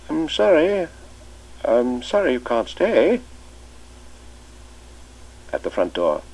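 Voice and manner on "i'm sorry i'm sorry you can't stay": higher, louder, slow fond goodbye; the master's ritual send-off; old familiar farewell. Acted. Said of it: "his old familiar formula".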